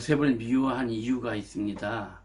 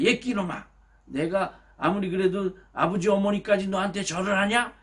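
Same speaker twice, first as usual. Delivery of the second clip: higher, louder mock scolding; father scolding; 6 semitones higher, 3 dB louder than usual. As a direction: scold the boasting son. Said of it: "이렇게 야단을 쳤어요".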